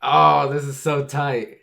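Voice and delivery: loud, high, real fan thrill; hyped-up exclamation; excited. Real, not acted. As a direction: burst out with delight, very loud at first. Hyped reaction to the reveal.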